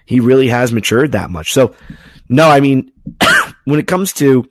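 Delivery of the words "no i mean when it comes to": strained, hoarse voice; pushing through a strained voice; straining hard. Real, not acted. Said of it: "You guys can all hear I'm kinda straining my voice".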